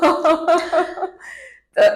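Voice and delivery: loud, high nervous burst of laughter. Real, not acted. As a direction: laugh out from nerves. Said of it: "si no me río de nervios".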